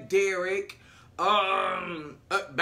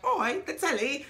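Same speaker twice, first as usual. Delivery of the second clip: mock London accent, pitched up; fake British accent; 6 semitones higher than usual. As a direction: put on a silly British accent. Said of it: "she got this poppycock, like, accent".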